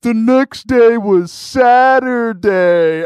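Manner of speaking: loud, high, drawn-out call; imitated crowd heckler. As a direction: call it out slowly to the whole room. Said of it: "somebody from the back of the room said".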